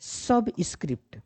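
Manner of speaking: slow, measured subscript with a fall; careful term reading. Shown as a demonstration. Teacher asks learners to read carefully, models it slowly.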